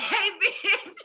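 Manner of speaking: high, loud quote breaking into laughs; laughing quote. Retold or imitated. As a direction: repeat the line through laughter. Repeats film quote while laughing.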